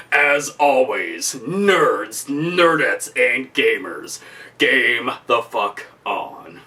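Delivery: low, slow outro catchphrase; slow signature sign-off. Acted. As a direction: drawl the channel's closing line low.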